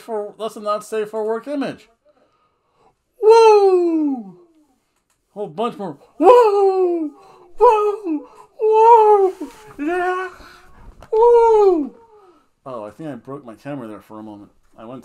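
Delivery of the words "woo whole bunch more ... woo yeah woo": shrill, very high, loud woo; Ric Flair woo. Retold or imitated. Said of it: "Too many woos".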